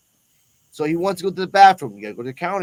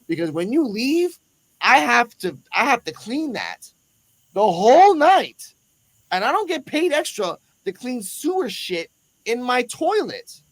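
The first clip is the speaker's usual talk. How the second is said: annoyed clerk; 5 semitones higher than usual. Retold or imitated. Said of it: "I'm gonna translate what she said".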